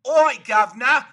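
mock-British street holler; British accent. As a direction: belt out a cartoonish British greeting. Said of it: "All right, British accents, go".

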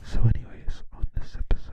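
unvoiced joking whisper. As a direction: whisper the transition. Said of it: "Way to transition".

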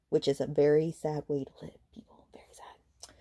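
whispered sad aside. Real, not acted. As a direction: whisper it, almost unvoiced.

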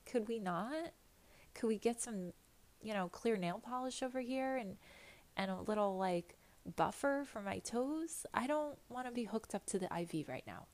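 soft inner-voice pleading; private thought. Retold or imitated. Retelling a silent plea to the nurse, soft inner voice. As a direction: keep it quiet, as if only thinking it. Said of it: "And I'm thinking".

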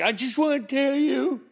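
high, loud impression of a smoker neighbor; smoker's voice. Freaked-out neighbor warns the family, smoker's voice. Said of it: "She had that smoker's deep voice".

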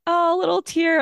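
high-pitched little coo, playfully moved; mock touched. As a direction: act touched to a tiny tear. Acting out being touched by support.

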